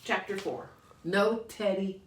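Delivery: ordinary delivery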